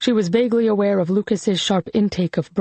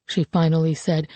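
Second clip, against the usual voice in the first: ordinary delivery, nothing marked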